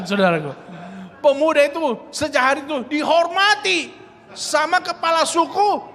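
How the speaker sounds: usual speaking voice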